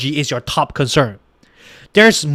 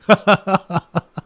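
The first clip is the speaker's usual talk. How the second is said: real laugh; 4 dB quieter than usual. Real, not acted. Laughing at poll.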